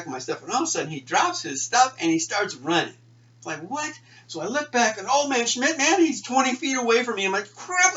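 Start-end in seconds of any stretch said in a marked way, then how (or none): 3.6-3.9 high startled cry. Retold or imitated. Startled kid. 7.5-7.9 high, loud panicked curse. Retold or imitated. Sudden panic at being caught.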